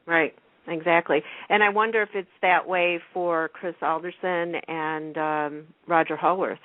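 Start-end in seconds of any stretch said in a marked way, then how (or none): none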